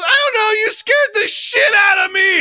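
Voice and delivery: high, screamed mock outburst; hammed-up tantrum yell, drawn out; imitating furious child. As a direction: shriek it like an upset kid. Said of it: "She got so mad at me".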